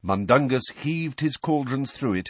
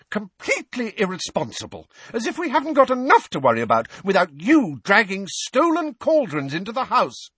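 much higher, louder scolding rant; angry tirade; 13 semitones higher, 5 dB louder than usual. Acted. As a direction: keep tearing into him, full steam. Mother still shouting downstairs.

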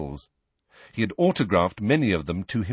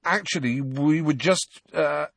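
higher, halting and unsure; hesitant excuse; 6 semitones higher than usual. A narrator's reading. Ron trying to get out of a visit, hesitant. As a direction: stall awkwardly, dragging the last words.

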